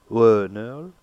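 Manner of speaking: "Wa" loud, then "neul"; stress on WA. Shown as a demonstration. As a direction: lean hard on the first syllable "Wa". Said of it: "The stress is on the first".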